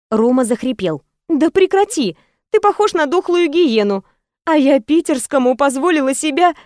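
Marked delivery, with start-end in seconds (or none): 1.3-6.5 high, exasperated mock scolding; playful indignation. A narrator's reading. Woman scolding her lover for playing dead, playfully indignant. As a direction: protest the silly joke in a raised pitch. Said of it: "возмутилась глупой шутке я".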